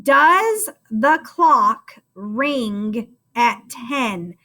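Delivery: very slow choral reading, each word spaced; slow dictation. Shown as a demonstration. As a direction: drag it out so the kids can follow along. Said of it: "say it with me".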